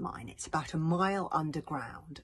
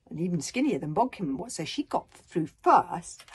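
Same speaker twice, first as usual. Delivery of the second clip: rising, urgent reading that breaks at STUCK; dramatic story narration; 3 semitones higher, 7 dB louder than usual. Acted. Author reads Bodkin getting jammed, dramatic narration. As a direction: tighten the panic as the tunnel narrows. Reads the tight tunnel scene.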